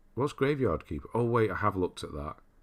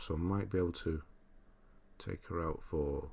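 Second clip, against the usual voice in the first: ordinary delivery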